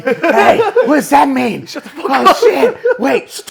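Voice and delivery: talking through laughter; laughing. Real, not acted. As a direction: giggle while acting it out.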